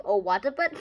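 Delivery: ordinary talk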